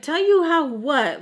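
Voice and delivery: confused retort; incredulous. Retold or imitated. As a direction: throw it back in disbelief. Incredulous reply to boyfriend.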